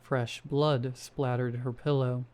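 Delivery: usual speaking voice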